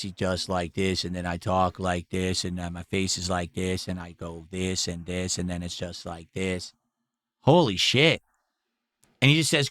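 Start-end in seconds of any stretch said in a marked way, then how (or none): 0.0-6.7 much softer and lower, dead monotone; monotone LTG impression. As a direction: mimic him with no emotion at all, quiet and low. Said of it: "very monotone, no inflection in his voice".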